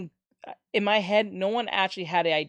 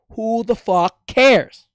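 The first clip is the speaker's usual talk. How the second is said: loud, slow, drawn-out outburst; who the fuck cares, shouted slowly; exasperated shout; 4 semitones higher, 11 dB louder, much slower than usual. Real, not acted. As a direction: yell it in disbelief. Ranting about voice casting.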